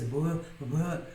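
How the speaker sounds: a buzzing v, teeth on lip; v sound with lips and teeth. Shown as a demonstration. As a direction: press teeth on lip and buzz the v. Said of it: "não tem v no português".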